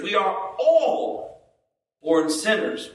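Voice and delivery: "all" drawn out high, then a pause; stress on all. Shown as a demonstration. Preacher showing each word matters, stress on "all". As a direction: hit "all" high and long, then break. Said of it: "Any word we could emphasize there".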